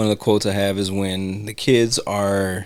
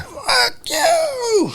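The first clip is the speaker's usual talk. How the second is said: mock yell. She just yells it out.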